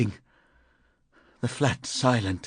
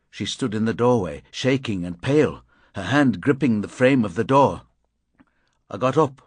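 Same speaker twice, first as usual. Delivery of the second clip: ordinary delivery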